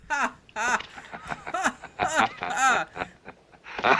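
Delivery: loud staccato mock cackle; hammed-up ha ha laugh, climbing high; villain laugh. Imitating the gunman's long laugh at Sabata, loud staccato mock laugh. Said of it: "He's just like".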